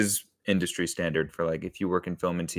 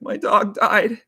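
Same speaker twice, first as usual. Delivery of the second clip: high-pitched, louder tearful wail; mock crying; 13 semitones higher, 5 dB louder, slower than usual. Crying guy tells his friend about his dog, mock crying. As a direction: cry it out in a hammed-up way. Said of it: "a guy crying".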